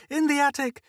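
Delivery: high, loud desperate scream. A narrator's reading. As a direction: scream to drag him upstairs. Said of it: "she screamed".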